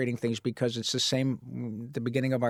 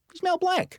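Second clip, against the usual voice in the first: awed. Real, not acted. Awed about a famous idol.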